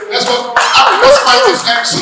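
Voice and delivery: high, loud hype call to the room; rally the crowd like an MC; excited call. Real, not acted. MC rallying the event crowd, shouting high and loud.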